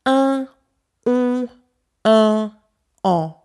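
"un" and "en" as isolated French nasal vowels. Shown as a demonstration. Teacher lists nasal sounds, "un" and "en" held apart. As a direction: sound out each "un" and "en" separately, through the nose. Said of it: "nasal vowels".

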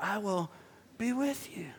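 very soft, fading almost to a whisper; hushed promise. Retold or imitated. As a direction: quote it gently, barely voiced at the end. God's promise, quoted softly.

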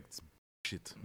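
put-on quiet throwaway Nemesis accent. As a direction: mutter it in a bad Nemesis accent. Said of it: "That's my terrible Nemesis accent".

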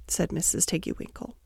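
usual speaking voice

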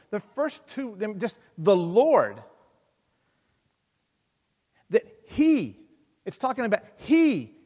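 preaching. Acted. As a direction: declare it boldly to the church. Preaching climax.

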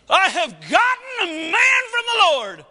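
joyful shout. Acted. Eve celebrating her firstborn son, loud exultant shout. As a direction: shout the news to the world.

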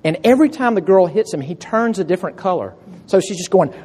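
ordinary delivery

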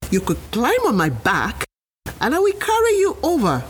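lightly sly offer, rising high at the end; a touch of fake kindness. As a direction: alligator luring the monkey, sweetly. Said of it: "the alligator offered".

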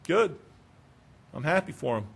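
soft, low, uninvested approval; mock lack of interest; indifferent. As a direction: say it as if it's nothing to you. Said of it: "They were indifferent".

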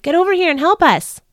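high, loud holler for help. Acted. Peter hollers to Thomas and Nathaniel for help. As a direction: yell across the boat for help. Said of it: "I hollered".

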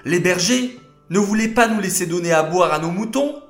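put-on high, fast, eager little girls' voices; breathless kids blurting out news; excited girls. Daughters rushing to tell their father Yitro, high and excited.

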